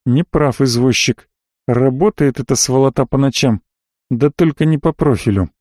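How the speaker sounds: ordinary delivery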